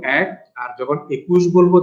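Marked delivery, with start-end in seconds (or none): 0.0-0.3 এক as "æk"; teacher's demonstration of এক, open vowel; open-vowel pronunciation. Shown as a demonstration. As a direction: model এক with অ্যা at the start. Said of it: "অ্যা দিয়ে বলবো".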